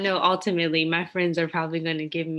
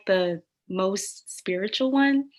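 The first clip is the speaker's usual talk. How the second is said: ordinary talk, nothing marked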